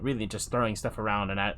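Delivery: normal talking voice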